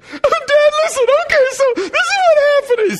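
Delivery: put-on a kid begging to explain; pleading little boy.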